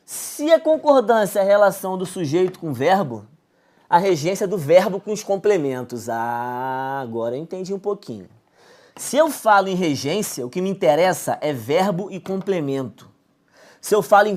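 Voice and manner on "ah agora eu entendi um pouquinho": softly voiced student's realization; student catching on. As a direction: play a student quietly getting it at last. Voicing a student who finally understands.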